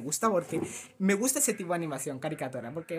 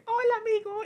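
very high, louder squeaky hello; mock greeting; 6 dB louder than usual. Imitating the sudden friendly greeting, very high squeak. Character voiced greeting abruptly.

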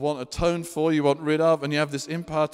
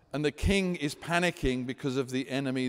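ordinary talk

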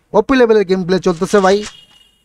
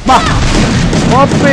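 high, loud gasp of praise; excited; 6 semitones higher, 3 dB louder, much slower than usual. Real, not acted. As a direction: cheer the play out loud. Caster reacts to a big play.

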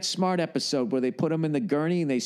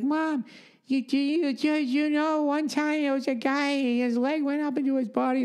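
put-on high excited child voice; little kid telling mom; 13 semitones higher than usual. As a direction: blurt it out like a wide-eyed kid. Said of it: "I'd be like".

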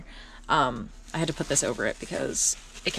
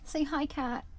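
lightly higher coaxing voice for the cat; cat talk; 9 semitones higher, 6 dB quieter, slower than usual. Real, not acted. Owner coaxing her cat with a lightly higher voice. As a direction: coax the cat to say hi.

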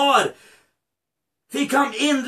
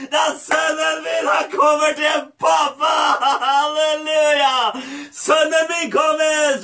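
joyful cry; 3 semitones higher than usual. Acted. Father announcing his son's return, joyful cry. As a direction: cry it out in jubilation. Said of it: "Så faren og skreik ut".

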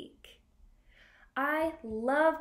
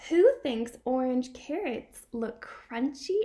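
slow, bright storybook reading with gasps; reading to a toddler; 4 dB louder, slower than usual. Acted. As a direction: read it to a small child full of wonder. Reading picture book to child.

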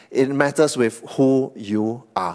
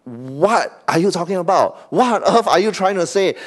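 higher, with a laugh of disbelief; incredulous; 6 semitones higher than usual. Retold or imitated. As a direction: ask as if it's absurd. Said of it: "he is incredulous".

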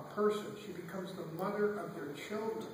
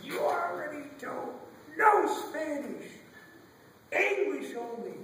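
high, slow, drawn-out command; mock screaming teacher; 7 semitones higher, 4 dB louder, slower than usual. Playground teacher banning Spanish, high mock scream. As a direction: stretch each word as a scolding teacher. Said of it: "she starts screaming".

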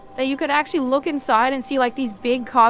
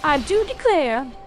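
mock British accent, pitched up; put-on British; 2 semitones higher, 3 dB quieter than usual. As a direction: play at being a caricature Brit. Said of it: "I'm British".

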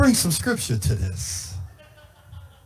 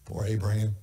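1 pitying murmur; 13 semitones lower, 9 dB quieter than usual. Real, not acted. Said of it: "pitying aside".

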